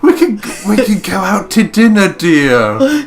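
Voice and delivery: high, loud sing-song laughing imitation; mock sweet wife. Mocking the wife's dinner offer, high and laughing. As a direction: simper it through laughter. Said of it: "I'm like, the fact it was like".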